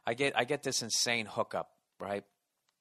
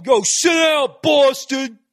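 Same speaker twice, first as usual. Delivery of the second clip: very loud, high shouted heckle; heckler's bellow; 13 semitones higher, 16 dB louder, much slower than usual. Retold or imitated. Man heckling Boston at the All-Star game, bellowing. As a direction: holler it across an arena with stretched words. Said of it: "I hear this guy's voice".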